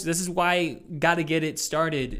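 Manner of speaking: ordinary talk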